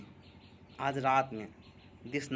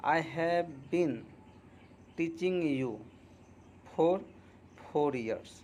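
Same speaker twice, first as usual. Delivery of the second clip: slow dictation; 2 semitones higher, much slower than usual. Shown as a demonstration. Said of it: "तो क्या कहेंगे".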